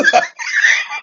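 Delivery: breathy high-pitched laugh burst; real laugh at the chat. Real, not acted. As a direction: let a high, airy laugh escape. Cracking up at chat.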